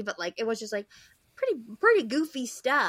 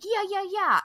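high, louder mocking "yeah yeah"; mock eager agreement; 10 semitones higher, 6 dB louder than usual. Mocking Spud agreeing with friends, high sarcastic voice. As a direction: ape a spineless yes-man. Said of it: "And he's like".

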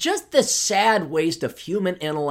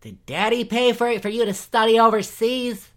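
mocking; 5 semitones higher than usual. Mocking the reporter's privileged upbringing.

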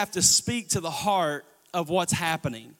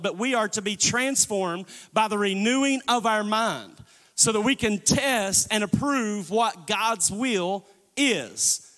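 ordinary delivery

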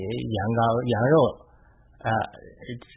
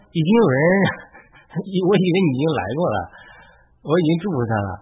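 put-on startled old father; 6 semitones higher, 6 dB louder than usual. Isaac realises he was tricked.

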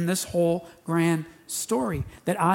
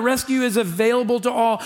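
quick restatement; 6 semitones higher, 5 dB louder, faster than usual. Shown as a demonstration. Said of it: "Did you hear that?".

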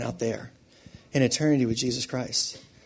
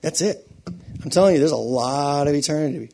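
ordinary delivery

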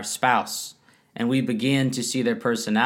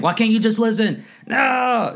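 high, louder exasperated plea; 9 semitones higher, 4 dB louder than usual. Pretend. Frustrated spouse snaps at partner who won't listen, exasperated and high. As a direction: throw up your hands, fed up.

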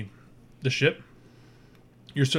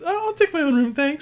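high, bright-pitched alien voice; high alien crew member; Kritka's voice; 4 dB louder than usual. Acted. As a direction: pitch way up for the spider woman. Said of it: "Kritka says".

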